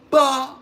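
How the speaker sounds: high, loud burst like a rim shot; snare crack imitation. Shown as a demonstration. Drummer showing the snare tone you want, loud crack. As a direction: punch it out as a drum crack. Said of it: "has that crack behind it".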